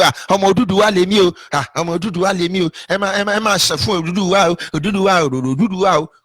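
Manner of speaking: quick, sing-song mock boasting; mocking imitation. Mimicking those claiming Oduduwa descent, fast mocking sing-song. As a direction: rattle it off as a repetitive mocking chant.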